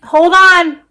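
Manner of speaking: real shout to make someone wait; calling back. Real, not acted. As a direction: call it out firmly to someone off camera. Answering someone off camera, louder.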